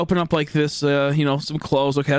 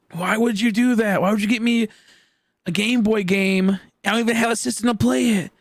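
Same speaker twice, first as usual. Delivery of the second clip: whiny protest; 5 semitones higher than usual. Retold or imitated. Acted inner protest at mom.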